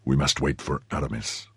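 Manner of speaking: low, soft agreement; grave Athos. A narrator's reading. Athos agreeing with his friend, low and grave. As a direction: concede it low and quietly.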